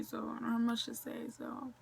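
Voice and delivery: whispered sign-off. Real, not acted.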